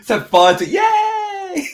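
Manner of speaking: high gleeful yell; gleeful shout. Acted. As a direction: cheer it out high. Gleeful cheer about candy cannon.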